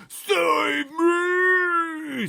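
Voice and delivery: very loud, high terrified giant scream. Acted. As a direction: scream in nightmare terror. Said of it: "screamed the Flesh Lump Eater".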